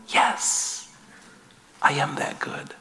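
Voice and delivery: soft unvoiced whisper from heaven; God's whisper. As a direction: breathe it like God answering gently. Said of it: "you can almost hear him saying from heaven".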